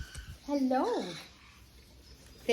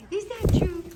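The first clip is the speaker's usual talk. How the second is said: higher, louder teasing question; playful louder ask; 9 semitones higher, 7 dB louder than usual. Real, not acted. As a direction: tease the bird, voice up and loud. Teasing loud birds.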